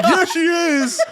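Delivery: very high mock yell, half laughing; imitated dad's scream. Co-host re-enacts a dad at the concert, laughing mock scream. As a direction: imitate someone's dad yelling from the audience. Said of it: "some guy's fucking dad is just like".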